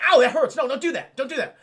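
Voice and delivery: high, loud recoiling protest; acted hurt, urgently telling her to stop; pained protest. Man stung by her alcohol rub, loud mock protest.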